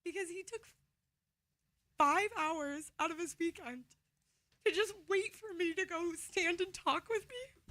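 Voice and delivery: getting emotional. Real, not acted. Radio host praising her dad, tearfully emotional. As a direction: push the words out slowly through welling tears. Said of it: "I don't know why I'm getting so emotional".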